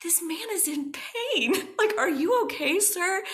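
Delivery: high-pitched, loud incredulous retort; indignant. Retold or imitated. Defending an injured man to a pushy coworker, indignant.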